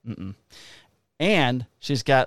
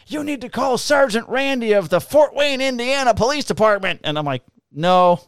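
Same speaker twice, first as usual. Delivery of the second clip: higher, louder retold yelling; mock yell; 10 semitones higher, 4 dB louder than usual. Cop imitating the arrested woman yelling from the car. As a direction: shout her demands like a drunk. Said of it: "she's yelling".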